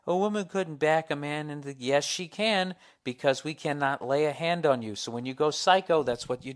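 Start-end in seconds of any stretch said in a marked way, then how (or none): 0.1-1.8 higher-pitched scoffing objector; mock incredulous outcry; mock disbelief. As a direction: imitate a doubter protesting in a raised voice. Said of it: "a lot of people are like".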